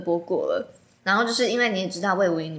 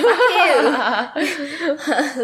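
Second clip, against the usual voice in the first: slow, drawn-out laughing curse, higher and louder; mock furious curse; 6 semitones higher, 5 dB louder, much slower than usual. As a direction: spit it out slowly while laughing. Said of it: "这样". (边笑边慢慢骂出来)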